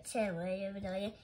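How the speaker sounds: ordinary delivery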